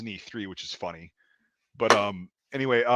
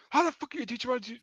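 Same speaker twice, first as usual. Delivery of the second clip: high, rapid angry demand; imitated screaming; 12 semitones higher, 6 dB quieter, much faster than usual. Gunner challenging the range safety, imitated screaming. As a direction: fire it off high, mimicking an irate gunner. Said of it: "he's just, like, screaming".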